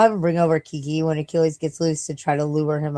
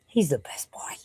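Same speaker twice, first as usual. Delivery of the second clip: doting whisper; 5 dB quieter than usual. Acted. Doting on the dog.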